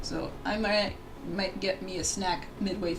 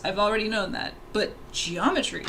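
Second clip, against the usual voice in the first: ordinary talk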